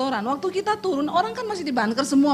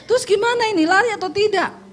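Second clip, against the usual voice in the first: panicky husband; 9 semitones higher than usual. Retold or imitated. Panicked husband voiced in story.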